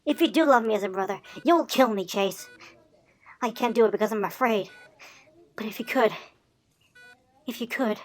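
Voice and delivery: soft, low begging; hushed, hopeless appeal; despairing plea. Acted. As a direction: beg quietly, worn down by despair. Begging brother to end his life.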